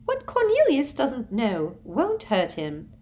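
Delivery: high, slow, prim dismissal; a sugary official brushing off the law; Umbridge voice. A narrator's reading. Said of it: "said Umbridge".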